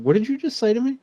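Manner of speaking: high disbelieving ask; stunned question. Retold or imitated. Father stunned by his daughter's words, asking high and disbelieving. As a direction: pitch leaps up in disbelief. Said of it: "And I was like".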